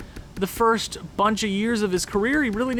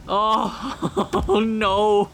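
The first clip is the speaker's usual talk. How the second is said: giddy relief; 2 semitones higher than usual. Real, not acted. Laughing after the trade.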